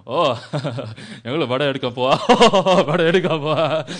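loud hammed-up laughing at own joke; jokester uncle. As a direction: guffaw through the line as a joking uncle. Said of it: "some uncle be like".

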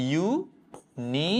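ইউনিভার্সিটি broken slowly into separate syllables; slow teaching breakdown of ইউনিভার্সিটি, starting on a "yoo". Shown as a demonstration. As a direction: sound out ইউনিভার্সিটি piece by piece, with pauses. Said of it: "sound হচ্ছে এরকম".

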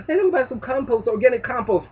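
sneering impression of a compost buyer; mock eager shopper.

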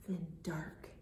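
low unvoiced whisper. Teacher whispering as clouds darken. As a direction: drop to a spooky hush. Story turns ominous.

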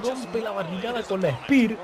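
high, rising hype call; excited play-by-play. Real, not acted. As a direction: call the big move at full hype. Spear through the barricade. (narración emocionada con el tono alto)

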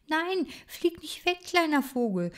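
high, urgent call after the bird. Acted. Bookworm calling to the frightened bird, high urgent call. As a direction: call out to stop the bird flying away. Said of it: "wollte ich rufen".

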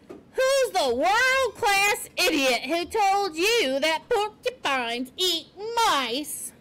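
high, very slow, emphatic demand; outraged porcupine. A narrator's reading. Porcupine demanding who spread the lie, outraged. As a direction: stretch every word in outrage.

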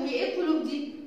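normal talking voice

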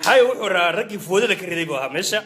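ordinary delivery

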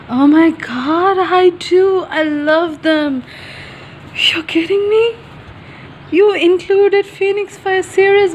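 high, real fangirl excitement; thrilled gushing. Real, not acted. Thrilled reaction to author's note.

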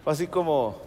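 ordinary talk

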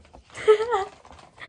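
high embarrassed laugh. Real, not acted. Laughs after reading own letter.

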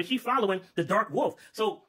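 usual speaking voice

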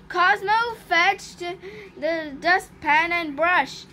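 ordinary delivery

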